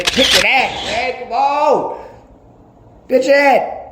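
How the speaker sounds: high, loud preacher hype; hyped. Real, not acted. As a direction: fire up the crowd.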